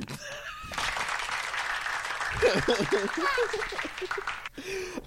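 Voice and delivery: long high wheezing laugh; helpless, drawn-out soft laughter; real laughing fit. Real, not acted. Host breaking up at a terrible pun, real laughing fit. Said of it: "start collecting [name]'s laugh".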